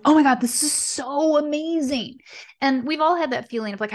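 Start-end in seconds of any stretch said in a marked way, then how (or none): 0.0-2.2 gushing, higher-pitched excitement; acted burst of thrilled enthusiasm; enthusiastic. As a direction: gush like an eager beginner.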